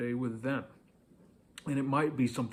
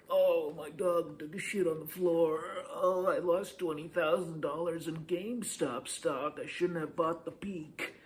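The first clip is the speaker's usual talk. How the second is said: high, hammed-up mock misery; sad and miserable; 5 semitones higher than usual. Shown as a demonstration. Coach mocks how not to share bad news, miserable. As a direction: whine it miserably, pitched well up. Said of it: "sad and miserable".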